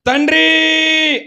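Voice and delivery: crying "తండ్రి" from the cross; loud cry. A narrator's reading. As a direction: call out "తండ్రి" slowly and loudly, like a cry from the cross. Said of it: "బిగ్గరగా కేకవేసి".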